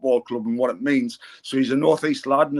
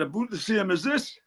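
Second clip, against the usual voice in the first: higher, softer North East comeback; broad local accent; 5 semitones higher, 6 dB quieter than usual. Retold or imitated. Visitor's punchline to Trump, broad North East accent. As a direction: deliver a deadpan joke in broad North East. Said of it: "can't understand your accent".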